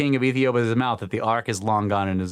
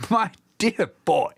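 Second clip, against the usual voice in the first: put-on high, slow, amused old gentleman voice; a lordly old man, laughing through the words; 8 semitones higher than usual. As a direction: play the startled old baron, chuckling as he speaks. Baron voiced in retold story.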